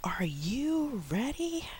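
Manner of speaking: high, slow, breathy sing-song question; teacher voice. Shown as a demonstration. Teacher asking a young learner if ready, high and slow. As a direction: ask it up high and slow for a small child. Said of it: "You say".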